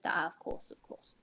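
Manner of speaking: soft whispered nodding agreement; sage whisper. Acted. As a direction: murmur like a wise sage. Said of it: "sage nodding from [name]".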